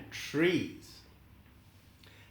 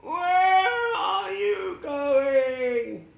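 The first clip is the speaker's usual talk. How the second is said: Scarecrow calling out; 10 semitones higher, much slower than usual. Acted. The Scarecrow asking Dorothy where she's going, high and slow. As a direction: shout the question across the field.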